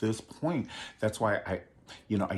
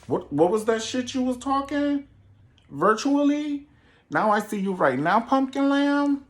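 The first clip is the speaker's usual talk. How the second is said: much higher, louder mock-confrontation voice; taunting diva; 5 dB louder than usual. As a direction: call her out, sassy and high. Taunting a rival at the mall.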